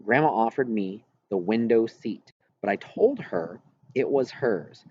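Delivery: usual speaking voice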